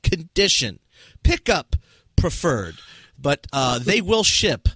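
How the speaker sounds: showy host voice selling the prize; game show announcer. Acted. Game show host reads listing.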